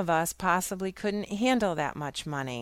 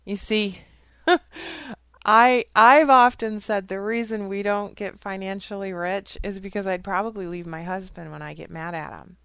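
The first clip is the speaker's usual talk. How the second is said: ordinary talk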